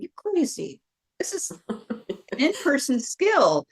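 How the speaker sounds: retold protest, pitch leaping up; incredulous objection. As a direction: act out mock outrage at a crazy idea. Retold objection to teaching online.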